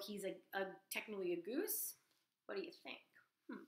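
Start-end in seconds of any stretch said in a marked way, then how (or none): none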